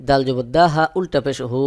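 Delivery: usual speaking voice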